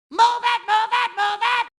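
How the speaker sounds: high, insistent repeated command; urgent calling out. Acted. Sponge trying to get through, urgent high call. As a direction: push through the crowd with your voice.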